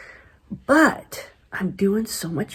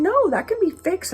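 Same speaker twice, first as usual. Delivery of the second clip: "No" pitched much higher, pressing the point; bright, emphatic therapist voice; insistent reassurance; 12 semitones higher than usual. Retold or imitated. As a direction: convince her it really can be fixed. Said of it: "And they're going".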